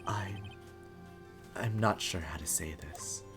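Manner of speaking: low, quiet, halting voice; reserved man fumbling for words; awkward, hesitant. Acted. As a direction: struggle softly to get it out, pitched low. Awkward Edgeworth speech in a game.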